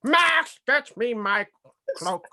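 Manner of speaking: high, loud put-on Grinch voice; Grinch impression. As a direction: bark the order at the dog like the Grinch. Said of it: "his mannerisms".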